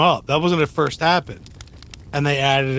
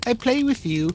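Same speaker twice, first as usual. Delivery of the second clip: put-on small high child's voice; little kid; 9 semitones higher than usual. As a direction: pipe up like a little kid, higher pitched. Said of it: "a little kid going".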